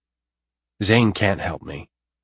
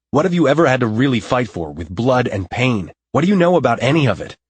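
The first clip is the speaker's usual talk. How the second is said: loud, raised, furious tirade; heated shouting, faster at the end; angry outburst; 5 semitones higher, 6 dB louder than usual. A narrator's reading. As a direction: hurl it at her, seeing red. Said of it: "Anger makes me see red".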